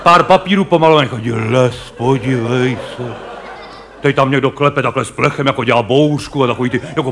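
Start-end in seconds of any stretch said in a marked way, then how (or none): 1.3-3.1 slow, low mock wonder; awed spectator. Comedian mimics a spectator pointing out the fake forest, slow and awed. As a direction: play a gullible theatergoer marvelling.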